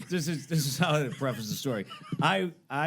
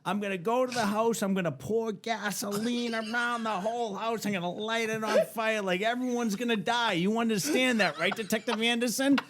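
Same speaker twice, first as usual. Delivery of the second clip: high hammed-up menace; threatening the detective while thinking it's a prank, pitched high; over-the-top mock threat; 9 semitones higher than usual. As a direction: go all in on the bluff, voice high.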